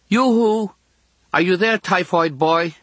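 put-on high-pitched girl calling out; girl's voice. A narrator's reading. Girl calling to unseen patient next door, high girlish voice. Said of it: "a girl's voice says".

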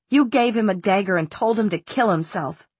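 usual speaking voice

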